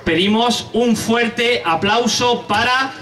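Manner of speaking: drawn-out build to a high, held name; stage announcer. Real, not acted. Host calls the first monologuist on for applause, rising announcer voice. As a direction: stretch the name out as a big stage introduction.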